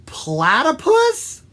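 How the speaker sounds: high, loud, slow puzzled question; drawn-out rising shout; mishearing woodpecker. Acted. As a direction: shout back a baffled guess.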